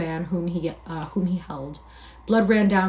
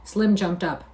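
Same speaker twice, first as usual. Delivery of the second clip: usual speaking voice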